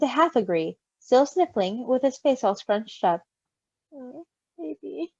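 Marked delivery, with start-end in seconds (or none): 3.9-5.1 tender coo. Real, not acted. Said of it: "cooing at baby".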